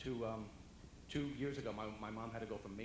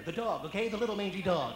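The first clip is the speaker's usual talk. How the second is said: snapping mom; 8 semitones higher, 13 dB louder than usual. Retold or imitated.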